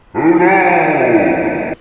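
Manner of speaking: booming giant mouse, very loud; Squeak. A narrator's reading. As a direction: boom out a huge greeting. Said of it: "he boomed".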